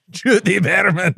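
high, drawn-out Scottish name; Scottish accent. Acted. Co-host saying the reviewer's name, Scottish accent. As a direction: stretch the name in broad Scottish. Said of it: "Time Magazine is a Scottish production".

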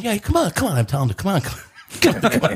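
high taunting come on. Retold or imitated. Taunting the seven-foot player to come get him, high. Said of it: "I'm going".

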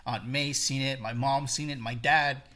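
ordinary talk

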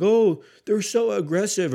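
hammed-up prissy critic voice; mocking complainers. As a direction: parody the people who complain. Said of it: "when people are like".

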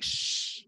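unvoiced "shh" hush; quick, quiet shush in a retelling. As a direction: hush someone with a soft shh. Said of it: "he got quiet, like".

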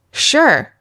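"sure" said as a falling statement. Shown as a demonstration. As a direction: drop the pitch firmly on "sure". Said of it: "the falling tone".

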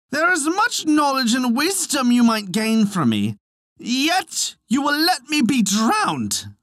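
high-pitched, drawn-out protest; crying out. A narrator's reading. Wee poet about to drown in ale, crying out. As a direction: plead indignantly in a high, sustained voice. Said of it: "he cried".

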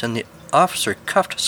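plain reading voice

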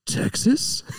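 high, airy surprised "Texas?"; breathy high mock surprise; mock surprised question. Reviewer mimicking a character's surprise, high and unvoiced. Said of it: "another character says".

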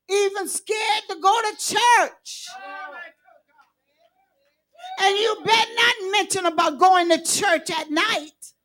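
fiery preaching to the congregation. Acted. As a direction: build the sermon to a shout. Preacher builds to full force.

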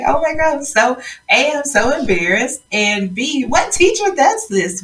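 high, flustered retold panic; embarrassed shock. Student mocked by her speech teacher, high embarrassed shock. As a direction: blurt it out like a mortified student. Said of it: "I was just like".

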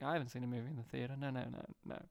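imitating the director, soft and rushed; mock quiet denial. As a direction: mutter it fast and sheepish. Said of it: "he was like".

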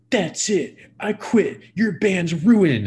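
hammed-up tantrum of the quitting bassist; mock huffy quitting. Imitating the floored bassist storming off, mock huffy tantrum.

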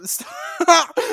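loud high yell; laughing shout. Real, not acted. Yelling through laughter.

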